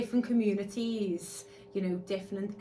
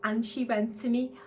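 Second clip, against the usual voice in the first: normal talking voice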